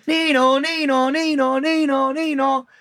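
high two-tone nee-naw wail; imitated police siren. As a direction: mimic a siren with your voice. Mimics police siren arriving in role-play.

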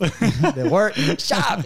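very slow, high hammed-up radio voice. Repeating the workshop plug as a joke, exaggerated radio voice. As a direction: draw each word out like an announcer, laughing. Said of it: "That is my radio voice".

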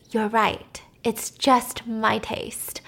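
softly retold, slowing right down; hushed and deliberate, imitating a tense wife; quiet, measured reply. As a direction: let the words drop softly, one by one. Wife's tense retold reply to husband.

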